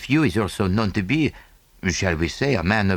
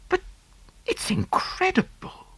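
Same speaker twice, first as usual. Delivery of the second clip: fascinated whispered mutter. Acted. Hastings mutters to Poirot in awe. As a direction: breathe it out in awe. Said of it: "I muttered, fascinated".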